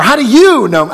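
loud, high, blunt challenge. Shown as a demonstration. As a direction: fire the question back bluntly. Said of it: "how do you enunciate that question".

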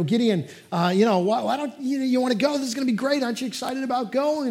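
high, loud, eager coaxing; dad selling the trip to his son, high and eager. Retold or imitated. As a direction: talk a toddler into the trip, bright and pleading.

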